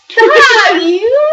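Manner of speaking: high laughing stop; laughing shout. Real, not acted. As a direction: yelp it out through laughter. Playful protest while laughing.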